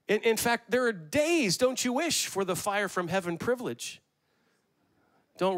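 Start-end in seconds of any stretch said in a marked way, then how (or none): 0.1-4.0 rally the congregation; preaching. Acted. Pastor asking the congregation, rising sermon fervor.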